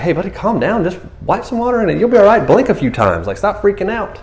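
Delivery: high-pitched, lightly acted calming down; exasperated reassurance. Bystander to someone with dirt in the eye, high exasperated reassurance.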